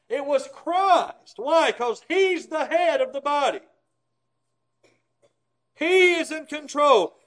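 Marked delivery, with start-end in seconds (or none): 0.1-1.3 It was Christ said very slowly, higher and louder; emphasized. Shown as a demonstration. Preacher stressing to his congregation, slow emphatic. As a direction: lean hard on each word of It was Christ. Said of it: "Let's emphasize that".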